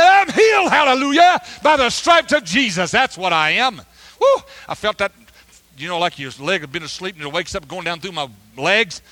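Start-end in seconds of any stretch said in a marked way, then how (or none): 0.0-3.8 much higher and louder, shouting praise; scream of healing. Retold or imitated. Preacher reenacting his roadside cry to the stranger, full shout. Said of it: "When I screamed it". 4.2-4.4 woo. Retold or imitated. Joyous shout after healing.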